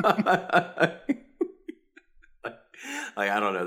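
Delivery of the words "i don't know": usual speaking voice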